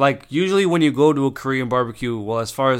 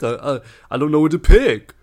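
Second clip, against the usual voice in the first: loud, high stammering mock panic; mock scaredy cat; 4 semitones higher, 9 dB louder than usual. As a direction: imitate a flustered waiter, voice climbing. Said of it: "the biggest fucking scaredy cat".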